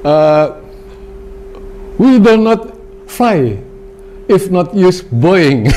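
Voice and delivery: high, slow quoted English; drawn-out mock quote of a friend. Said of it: "temen kita nih bilang begini".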